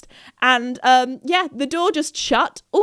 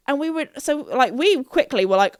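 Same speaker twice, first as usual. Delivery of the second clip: ordinary talk, nothing marked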